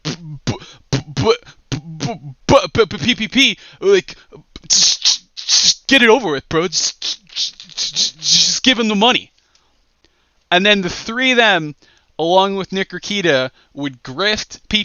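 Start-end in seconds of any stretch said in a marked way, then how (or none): 0.0-9.3 stammering Andy. Retold or imitated. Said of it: "Andy would be like".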